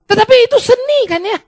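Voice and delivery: excited exclaim. Real, not acted. Preacher exclaims point.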